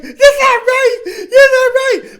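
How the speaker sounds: very high, slow fake sobbing; mock crying Karen. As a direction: wail it like Karen who didn't get her way. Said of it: "you see Karen in the mall".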